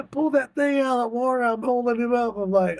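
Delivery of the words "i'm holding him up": normal talking voice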